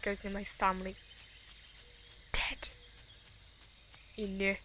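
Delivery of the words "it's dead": whispered threat; dark whisper. Real, not acted. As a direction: hiss the threat softly.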